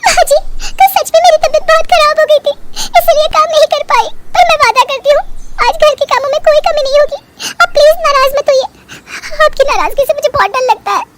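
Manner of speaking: high, sugary put-on meekness; meek fake bahu. Bahu plays meek before husband.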